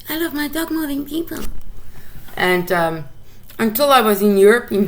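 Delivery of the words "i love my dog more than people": mock pet lover. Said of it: "these people who say".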